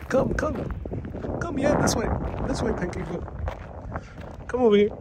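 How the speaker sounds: high coaxing pet-calling voice; calling a lost pet. Pretend. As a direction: call it over like a lost dog. Coaxing the pancake boat over.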